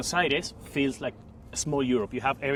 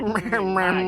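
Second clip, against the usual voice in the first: "Mate" drawn out nasal, Australian style, louder; nasal Aussie "mate"; Australian accent; 5 semitones higher, 6 dB louder than usual. Shown as a demonstration. Teaching the Australian "mate", nasal accent. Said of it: "Now you have to nasal".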